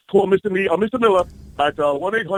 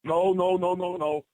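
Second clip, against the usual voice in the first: usual speaking voice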